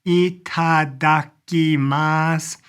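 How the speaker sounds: very slow ITADAKIMASU, each syllable separated. Shown as a demonstration. Teacher introducing a new word, slowly syllable by syllable.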